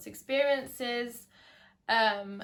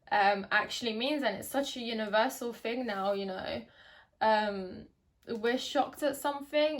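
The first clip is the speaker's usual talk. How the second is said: ordinary delivery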